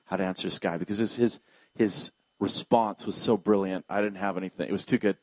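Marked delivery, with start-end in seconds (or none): 0.1-0.8 soft, low murmured prayer; hushed plea for boldness; quiet prayer. Retold or imitated. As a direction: pray under your breath. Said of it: "So I prayed".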